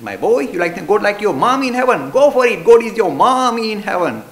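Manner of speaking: loud, rising enthusiastic blessing; emphatic encouragement. Retold or imitated. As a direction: urge him on with fervour. Preacher encouraging child loudly.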